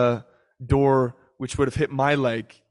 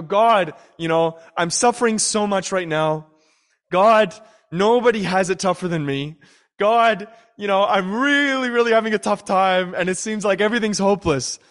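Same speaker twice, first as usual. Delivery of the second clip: higher and louder, pleading self-pity; whiny complaining prayer; 5 semitones higher, 4 dB louder than usual. Retold or imitated. A believer whining to God about his suffering, pleading. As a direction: whine to God like nobody has it worse.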